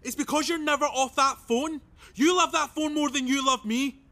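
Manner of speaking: aggrieved partner in a quarrel; heated accusation. Acted. Lovers' quarrel over the phone.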